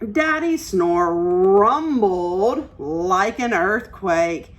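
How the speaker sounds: usual speaking voice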